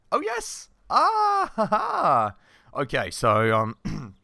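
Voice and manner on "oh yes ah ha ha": high, loud triumphant laugh; delighted cheer. Real, not acted. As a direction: burst into a real victorious "ha, ha". Cheers as character gets treated.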